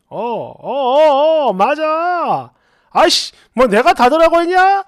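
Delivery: from hesitant mumbling to a loud irritated snap; drunk husband getting angry. Retold or imitated. Drunk husband snapping at his wife on the phone, hesitant then angry. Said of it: "이렇게 화를 내는 거예요".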